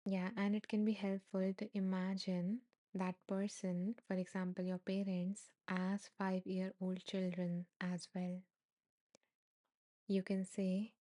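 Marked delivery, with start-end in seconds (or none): none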